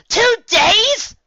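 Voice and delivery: disbelieving high yell; shocked cry. Acted. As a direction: blurt it out in alarm. Twilight shoots up, alarmed.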